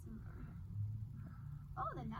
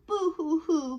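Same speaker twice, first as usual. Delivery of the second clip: hammed-up boo hoo, higher and louder; mock crying; 4 semitones higher, 16 dB louder, slower than usual. Piggy brother pretending to cry over the mud, mock sobbing. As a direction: fake a little piggy sob. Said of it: "cried Piggy's brother".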